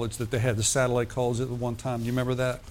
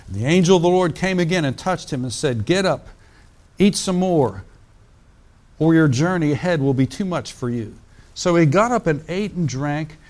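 reading the Bible aloud to the congregation, a touch louder; scripture reading; 2 semitones higher, 3 dB louder than usual. Acted. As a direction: lift the passage from the page like a preacher. Preacher reads scripture aloud.

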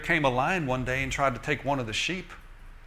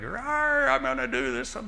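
much higher hammed-up monster roar; a mock giant growling threats at Israel; giant's roar; 12 semitones higher than usual. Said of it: "Goliath is doing one of his daily things, like".

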